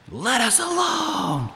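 high, drawn-out demon scream; agonizing cry. Acted. Demon upset that Jesus was there, agonizing cry. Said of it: "agonizing cry".

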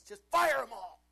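loud, high mock shout of a furious boss; angry boss yell. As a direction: bellow it like a raging boss. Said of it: "he goes".

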